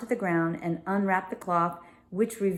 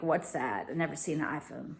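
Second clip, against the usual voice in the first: quiet puzzled aside; 3 semitones lower, 8 dB quieter than usual. Retold or imitated. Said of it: "they are like".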